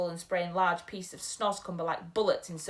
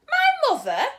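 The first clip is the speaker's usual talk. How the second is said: much higher, much louder outburst; startled cry; 12 dB louder than usual. Acted. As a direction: cry it out in disbelief. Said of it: "cried the B F G".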